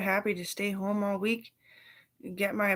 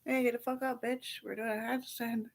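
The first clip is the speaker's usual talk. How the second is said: mock drill sergeant; 6 semitones higher than usual.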